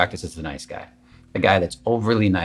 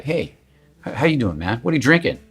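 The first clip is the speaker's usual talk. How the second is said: higher, pushed, overeager greeting; pushy fake alpha; 5 semitones higher than usual. Guy at the bar hitting on someone with pushed, higher-pitched eagerness. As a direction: push the energy up into the greeting. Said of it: "there's this push in their energy".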